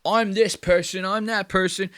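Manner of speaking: lightly mocking players announcing their characters; mimicking character intros. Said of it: "They go".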